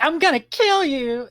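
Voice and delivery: high mock tsundere yell; tsundere threat. Guillotine voicing a tsundere threat, high and mock-loud. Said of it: "It'll be like".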